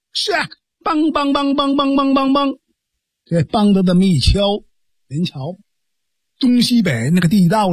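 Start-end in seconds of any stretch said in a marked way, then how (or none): 0.8-2.6 high, level, rapid-fire knocking syllables; imitated clapper. Storyteller sounding the signal clapper, imitated knocking. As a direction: rattle it out like a wooden clapper.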